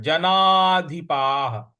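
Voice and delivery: जनाधिपाः slowly, with aspirated ध and a breathy ह after the visarga; drawn-out model of जनाधिपाः; slow pronunciation demo. Shown as a demonstration. Teacher repeats जनाधिपाः slowly for the class. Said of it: "ध पर छोटी की मात्रा है".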